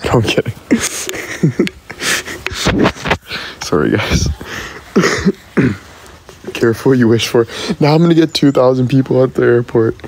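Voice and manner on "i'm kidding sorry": normal talking voice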